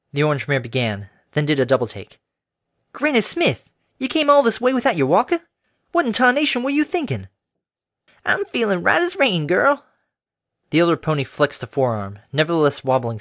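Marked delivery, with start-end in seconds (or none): 2.9-7.3 scolding farm mare. A narrator's reading. Applejack scolding her granny about the walker, worried. 8.2-9.8 put-on high, cheery elderly mare; a sweet old farm granny offering water. A narrator's reading. Granny offering water to the workers, high and cheery.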